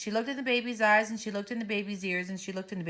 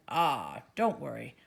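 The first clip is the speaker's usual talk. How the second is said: low, self-assured reassurance; a man who thinks he knows best; confident principal; 7 semitones lower, slower than usual. Acted.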